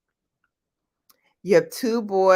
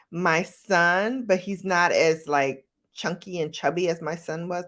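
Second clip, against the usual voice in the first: ordinary delivery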